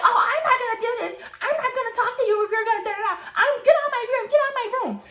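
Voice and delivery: high, fussy mimicry; flustered accuser. Accuser orders teller out of room, high and flustered. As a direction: imitate a rattled protest, pitch up.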